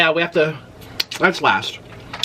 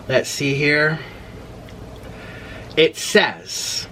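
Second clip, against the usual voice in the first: ordinary talk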